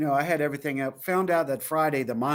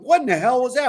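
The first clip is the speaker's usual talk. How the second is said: startled; 9 semitones higher, 6 dB louder, faster than usual. Retold or imitated. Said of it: "I was like".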